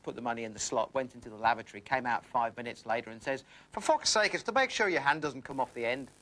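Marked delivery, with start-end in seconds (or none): 3.7-6.1 higher-pitched mock Irish accent; exasperated Irishman's accent for a punchline. Irishman comes out of the lavatory, exasperated Irish accent. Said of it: "this Irishman came along".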